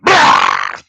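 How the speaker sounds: loud, long monster roar; werewolf roar. Retold or imitated. Costumed storyteller scaring a burglar, loud werewolf roar. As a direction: roar suddenly to terrify someone.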